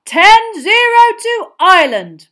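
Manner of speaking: loud, high and slow score call; loud announcement. A narrator's reading. Bagman booms the score to the crowd. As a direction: boom the score out.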